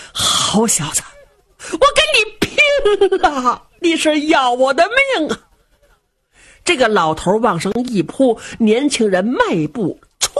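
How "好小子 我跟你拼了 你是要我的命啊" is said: lunge at him with the words; old man enraged. Acted. Old father, driven to fury by his son, enraged. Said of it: "这时候老头也急了".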